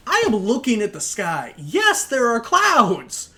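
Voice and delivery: loud, high, incredulous rant; exasperated rant. Real, not acted. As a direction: yell at the narrator in disbelief.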